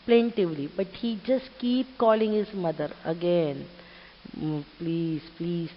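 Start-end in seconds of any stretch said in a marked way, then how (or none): 4.4-5.7 soft, low 'please'; quiet calm pleading. Acted. Chick keeps calling his mother, quiet acted pleading. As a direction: plead gently under the voice. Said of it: "like, you know, silently, calmly".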